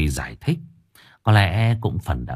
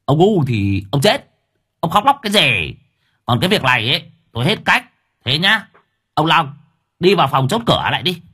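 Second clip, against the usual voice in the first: much higher, louder, shrill money-collector snap; shrill pawnbroker voice; 7 semitones higher, 3 dB louder than usual. Acted. Master suddenly turning on Hải in a shrill pawnbroker voice. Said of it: "đổi sang cái giọng cầm đồ thu họ quen thuộc choe choé".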